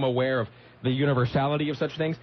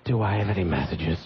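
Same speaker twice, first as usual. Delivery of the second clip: sexy DJ voice; 7 semitones lower than usual. Retold or imitated. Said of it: "turns to her reception and goes".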